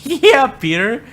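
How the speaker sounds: high, loud laughing exclamation; laughing jeer. Real, not acted. Mocking Peter for a stupid question, laughing jeer. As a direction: laugh out the words, drawn out and loud.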